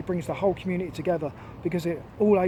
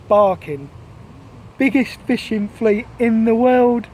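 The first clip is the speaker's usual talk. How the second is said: loud, slow proclamation, rising higher; declaimed headline; 4 semitones higher, 7 dB louder, slower than usual. Acted. Storyteller revealing the newspaper headline to listeners, loudly declaimed. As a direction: declare it grandly, stretching out each word. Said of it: "the headline of the story declared".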